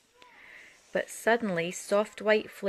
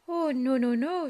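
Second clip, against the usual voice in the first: higher, louder mouse squeak of dread; frightened squeak; 6 semitones higher, 5 dB louder than usual. Acted. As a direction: squeak in little-mouse fear. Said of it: "squeaked Little Mouse".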